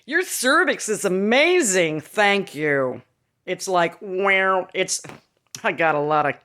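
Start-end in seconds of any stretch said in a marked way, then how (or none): 0.1-3.0 slow, delighted doctor voice; doctor's praise. Retold or imitated. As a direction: quote the thrilled doctor slowly. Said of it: "He was like".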